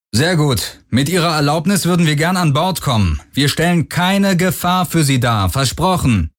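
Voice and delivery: high, projected announcement across the water; calling over loudspeaker. A narrator's reading. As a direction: call out as if through a loudspeaker. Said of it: "sagte eine männliche Stimme über Lautsprecher".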